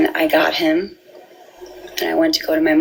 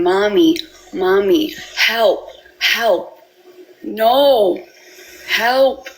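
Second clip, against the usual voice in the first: imitating son's cries; 4 semitones higher, much slower than usual. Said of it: "he cried and screamed".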